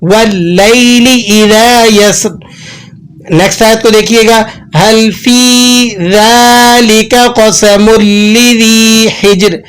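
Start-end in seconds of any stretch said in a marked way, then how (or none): 0.0-2.3 slow melodic tajweed recitation; Quran recitation. Shown as a demonstration. Recites verse. 4.8-9.6 slow melodic tajweed recitation; Quran recitation. Shown as a demonstration. Recites verse.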